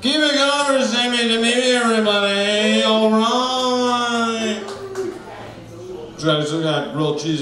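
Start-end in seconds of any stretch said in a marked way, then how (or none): none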